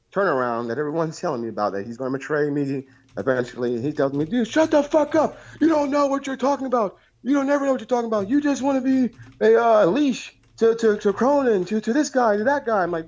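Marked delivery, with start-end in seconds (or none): 4.3-12.8 much higher mock rant at a buddy; imitating angry friend. Caller imitating his friend Bullfrog telling him off, high mock rant. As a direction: whine out his friend's furious brush-off, pitched way up. Acted phone quarrel, friend voiced.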